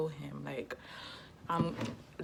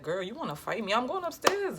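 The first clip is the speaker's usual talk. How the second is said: mock indignation; 6 semitones higher, 3 dB louder than usual. Imagined reaction of innocent cousin.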